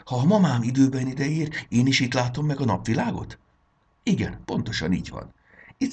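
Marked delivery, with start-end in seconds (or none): 0.0-3.4 put-on high, eager little girl voice; a small fairy girl asking, high and eager; excited child. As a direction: ask it breathless with hope.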